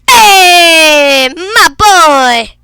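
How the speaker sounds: loud, happy hey to a friend; buddy just showed up, cheer him in; excited shout. Real, not acted. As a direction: call out with delight.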